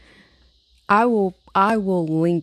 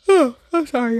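yawning apology; 7 semitones higher, 6 dB louder, slower than usual. Real, not acted. Sleepy vlogger yawning mid-sentence to her viewers. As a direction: yawn through "I'm sorry", high and slow. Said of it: "Why a yawn?".

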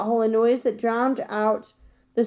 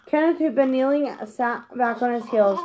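ordinary delivery

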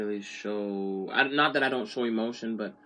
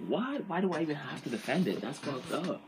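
high-pitched, disbelieving retort; incredulous; 4 semitones higher, 4 dB quieter than usual. Retold or imitated. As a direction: replay your baffled reaction, pitch jumping up. Retelling his incredulous reaction to her remark.

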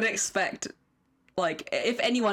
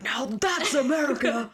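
higher, amused mock proclamation; Nicolas Cage declaring; 5 semitones higher than usual. Friend repeats Cage's bar line, mock declaring. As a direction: proclaim it with THAT'S stretched. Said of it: "he was like".

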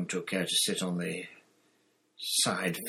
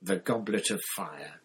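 usual speaking voice

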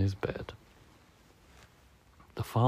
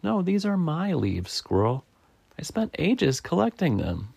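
lightly voiced, higher-pitched woodpecker; defensive Woodpecker; 8 semitones higher than usual. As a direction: protest a little high, guarding your property.

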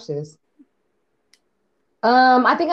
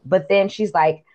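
ordinary talk, nothing marked

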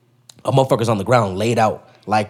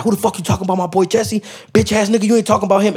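imitated homie jumping in, pitched up; high, indignant mock challenge; retold angry confrontation; 6 semitones higher, faster than usual. Homie storms out to defend his boy Jesse, imitated anger.

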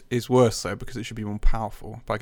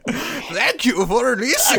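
put-on slow, higher old man voice; 10 semitones higher, 3 dB louder, slower than usual. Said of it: "The old man voice, definitely".

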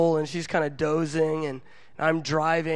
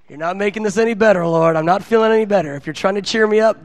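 louder, quickened comic grumbling; exasperated retort; 2 semitones higher, 6 dB louder, faster than usual. Retold or imitated. Preacher complains the Lord isn't cheering him up, exasperated retort. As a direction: push back at God, louder and fed up. Acts out grumbling back at God.

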